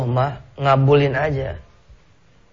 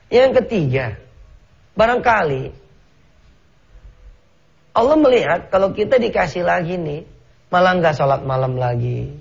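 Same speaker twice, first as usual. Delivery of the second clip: fervent preaching; 6 semitones higher than usual. Acted. Preacher builds to fervent appeal.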